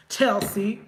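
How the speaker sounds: ordinary talk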